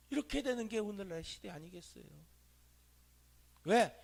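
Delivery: usual speaking voice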